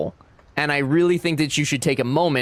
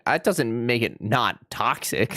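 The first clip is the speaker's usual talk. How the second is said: usual speaking voice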